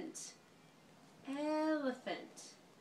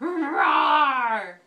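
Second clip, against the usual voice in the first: put-on big loud roar for the baby; playful bear roar; 3 semitones lower, 15 dB louder than usual. Mother roaring like a bear for her laughing baby.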